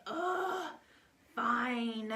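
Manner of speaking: loud, high huffy groan then a shouted fine; exasperated kid. Retold or imitated. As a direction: give in with a big annoyed huff. Daughter giving in, annoyed.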